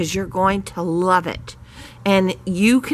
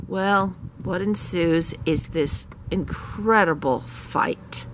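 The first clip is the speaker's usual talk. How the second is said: normal talking voice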